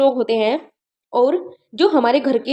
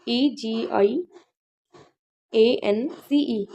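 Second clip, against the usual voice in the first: slow spelled letters; 2 semitones lower, 5 dB quieter, much slower than usual. Shown as a demonstration. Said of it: "याद कराने के लिए ऐसे बता रही हूँ".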